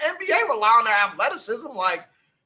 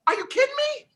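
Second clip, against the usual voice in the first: high incredulous cry; 12 semitones higher, faster than usual. Real, not acted. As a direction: pitch it way up in disbelief. Disbelief at opponent's claim.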